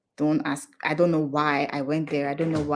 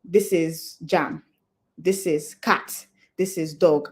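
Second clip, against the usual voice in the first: slow, deliberate naming of each word; slow flashcard reading; slower than usual. Shown as a demonstration. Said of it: "taking something and saying".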